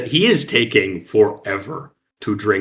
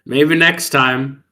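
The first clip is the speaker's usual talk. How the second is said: pointed drawl; 4 semitones higher, 6 dB louder, much slower than usual. Acted. Pointed repeat before the plain take.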